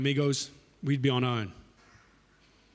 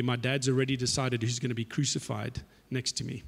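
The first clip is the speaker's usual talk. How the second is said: softer, lower knowing Jesus voice; 3 semitones lower, 5 dB quieter than usual. Retold or imitated. Jesus quietly informs James and John, knowing tone. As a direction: break the news quietly, knowingly.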